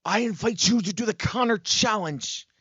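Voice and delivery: odd, distinctive character voice; Connor. Acted. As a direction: invite them in a comic mid-Atlantic accent. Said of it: "I'm trying to do Connor's voice".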